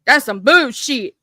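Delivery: usual speaking voice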